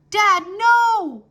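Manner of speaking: high, loud desperate cry. Acted. Son begging his father not to open the door, loud cry. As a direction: shout to stop your father. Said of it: "Wolfgang cried".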